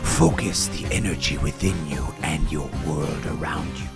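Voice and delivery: low, measured sage voice; solemn martial-arts mentor; wise mystical master. Acted. Plays wise master in story.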